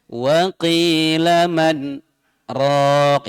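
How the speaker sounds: sung "wa qila man", then a stop before "raq"; hold, pause, then "raq"; saktah pause. Shown as a demonstration. Teacher stressing where the saktah falls. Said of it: "Itu ada saktah, ada jeda".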